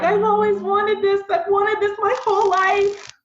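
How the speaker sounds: put-on high, gushing little boy voice; imitating her crying son. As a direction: pitch way up, overwhelmed young kid thanking. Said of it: "he was just like".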